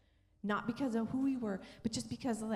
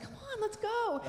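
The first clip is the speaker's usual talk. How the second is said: high, eager mock call; retold impatient cheer; eager urging; 11 semitones higher than usual. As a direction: urge them along in a raised, high voice. Retells urging her husband on.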